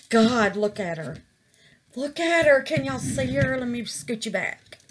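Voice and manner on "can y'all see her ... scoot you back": ordinary talk, nothing marked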